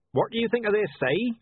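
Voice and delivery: a hint of a hearty joker; cheerful old man voice. A narrator's reading. Cheerful Mister Wintler.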